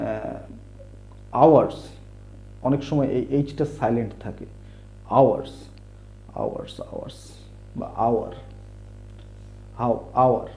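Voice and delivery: usual speaking voice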